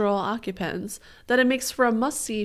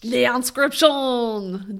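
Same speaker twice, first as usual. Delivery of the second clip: drawn-out mock French accent; hammed-up French delivery; 4 semitones higher, 5 dB louder, much slower than usual. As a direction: put on a slow, exaggerated French accent. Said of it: "do it with a French accent".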